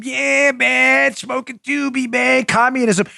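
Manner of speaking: high, lazy stoner drawl of a hippie; mock a dazed hippie from the sixties; stoned sixties hippie. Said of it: "remnants of the sixties generation".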